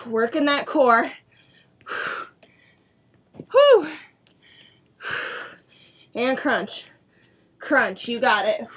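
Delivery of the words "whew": exhausted whew. Real, not acted. Exhale during core work.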